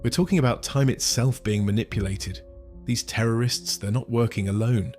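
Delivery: put-on serious northern accent. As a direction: warn John of the danger. Doctor turns serious.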